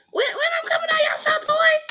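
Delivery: very high, quick squeak; mock pleading. Host teasing Tori about coming on the show, squeaky. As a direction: jump into a tiny high voice and rush it.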